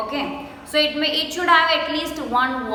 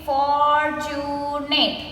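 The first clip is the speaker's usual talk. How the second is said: "fortunate" stretched as for-tu-nate; three long beats on "fortunate"; slowly broken up; much slower than usual. Shown as a demonstration. Teacher counting three syllables, "fortunate" slowly broken up. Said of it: "we say it slowly and we break the word".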